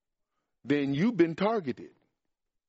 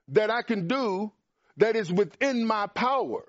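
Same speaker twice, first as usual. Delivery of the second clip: ordinary talk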